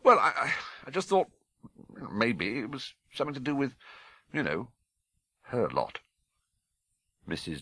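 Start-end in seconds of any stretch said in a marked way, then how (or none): 0.0-6.0 faltering, trailing off; nervous stammer. Acted. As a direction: stumble over the words.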